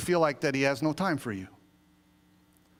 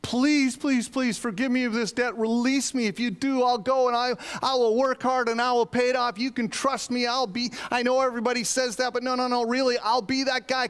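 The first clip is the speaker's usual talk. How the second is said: high, louder pleading voice of a desperate debtor; begging servant; 10 semitones higher, 4 dB louder than usual. Retold or imitated. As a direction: plead like a man about to lose everything. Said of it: "This guy's begging him".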